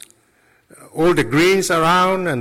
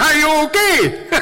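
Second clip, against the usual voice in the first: high, laughing through the question; laughing mock concern; 11 semitones higher than usual. Audience asking the priest, laughing mock concern. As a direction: giggle out the worried question. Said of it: "They said".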